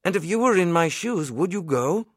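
a nervous lad asking his minister for advice; nervous plea. A narrator's reading. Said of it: "I stammered".

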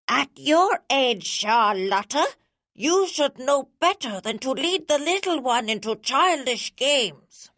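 dreadfully stern mother. A narrator's reading. Girl mimics her mother to tease her sister, dreadfully stern. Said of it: "in a dreadfully stern voice I said".

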